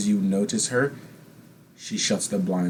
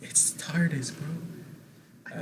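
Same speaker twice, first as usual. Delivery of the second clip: whispering; 6 semitones higher, 8 dB quieter, much slower than usual. Real, not acted. Joking aside whispered.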